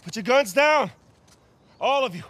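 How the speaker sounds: high, forceful shout; shouted order. Acted. Man to armed group in a standoff, shouted order. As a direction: order them all to disarm at full voice.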